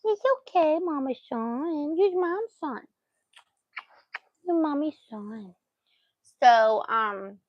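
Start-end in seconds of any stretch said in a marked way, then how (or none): 0.1-5.5 doting reassurance. Real, not acted. Owner telling her dog he is hers, doting coo.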